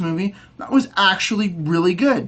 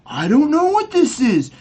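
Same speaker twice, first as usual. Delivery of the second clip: mock puzzled diggers; 5 semitones higher than usual. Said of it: "everyone's just like".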